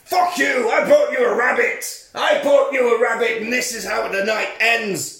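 put-on a hurt teenager shouting back; mock angry yell. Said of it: "he's like".

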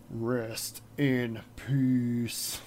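slow, low, hushed wrestler voice; Undertaker. Retold or imitated. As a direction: drag it out low and soft like a grim wrestler. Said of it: "my awful Undertaker impression".

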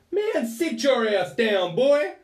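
loud, high-pitched American policewoman impression; drawn-out yell putting someone in their place; imitated shouted order. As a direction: holler it slowly in a put-on American voice. Police woman snaps back at him.

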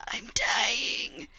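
weak dying whisper. Retold or imitated. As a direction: rasp out a breathless whisper. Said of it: "Be like".

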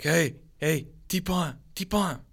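high-pitched mock call to passers-by; imitated calling out. Storyteller voicing water sellers waving travellers over, high calling voice.

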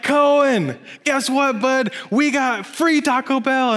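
put-on high, eager announcement to a little boy; excited to son. Father telling his four-year-old son Cohen about free food, excited. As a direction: bubble over telling your kid the news.

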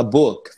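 "book" as one syllable; "book" with a short vowel and final k; correct English ending. Shown as a demonstration. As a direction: end "book" right on the k, no added i. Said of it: "você fala".

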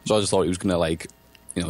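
ordinary talk, nothing marked

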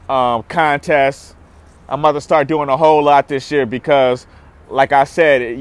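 ordinary delivery

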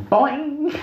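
high, springy boing noise; cartoon boing. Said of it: "my dick said".

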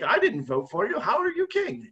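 a peasant's disbelieving challenge, voice climbing; incredulous peasant talking back to the king; indignant peasant. Retold or imitated. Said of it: "the peasant's like".

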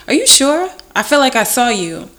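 high, loud insistent stranger; imitating the guy. Acting out the boy insisting he saw her, loud and high. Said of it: "he goes".

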